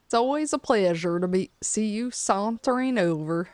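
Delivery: hammed-up, amateur southern accent; attempted southern accent. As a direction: have a shaky go at a southern accent. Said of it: "kinda sorta do a southern accent".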